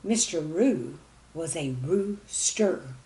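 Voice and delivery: ordinary delivery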